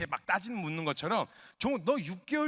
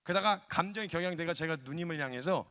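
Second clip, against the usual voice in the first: normal talking voice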